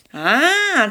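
high triumphant "ah"; old man's gleeful outcry; triumphant yell. A narrator's reading. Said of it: "торжествующе завопил старик". (торжествующий вопль)

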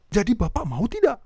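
a wrong-tone salesman closing; pushy rising close. Shown as a demonstration. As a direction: press the buyer with a rising demand. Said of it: "orang jadi terpancing untuk bilang tidak".

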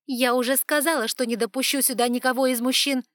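loud, high outraged protest; indignant. Acted. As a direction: refuse with indignation. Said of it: "возмутилась Эванс".

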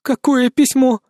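high, frightened woman's question; trembling voice. A narrator's reading. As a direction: ask it with a fearful tremor. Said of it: "дрожащим голосом спросила женщина".

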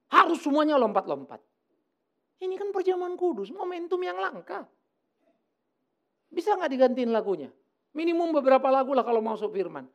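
high-pitched, indignant retelling of a complaint; retold exasperated protest. Menirukan protesnya kepada jemaat soal lagu. (protes kesal yang ditirukan, nada tinggi)

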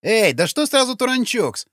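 high-pitched defensive comeback; squawky, aggrieved protest; snapped retort. A narrator's reading. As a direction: snap back indignantly in a high voice. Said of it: "огрызнулся сутенёр".

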